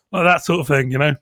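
normal talking voice

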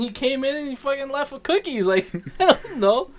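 high-pitched, confused rant; bewildered protest. Retold or imitated. Son explaining dad stole cookies, bewildered protest. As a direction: plead innocence in a high, baffled voice. Acts out his own bewilderment.

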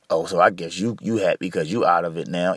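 imitating an ex. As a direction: drop the voice to mimic an ex accusing you. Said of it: "My ex. Mad at me 'cause".

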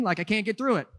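usual speaking voice

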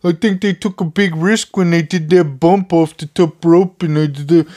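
a hint of a prissy complainer, mocked; mocking whiny fan. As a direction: mimic a squeamish fan you think is silly.

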